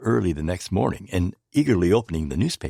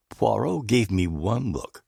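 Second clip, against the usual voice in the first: ordinary delivery, nothing marked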